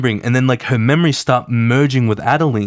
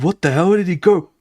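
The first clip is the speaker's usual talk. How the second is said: freaked out; 6 semitones higher, faster than usual. Retold or imitated. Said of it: "He's freaked out".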